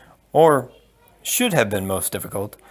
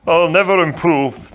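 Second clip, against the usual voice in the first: slow, higher, louder resigned giant; glum giant voice; 6 semitones higher, 5 dB louder than usual. Acted. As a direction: sigh it out as the giant. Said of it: "the Turk answered".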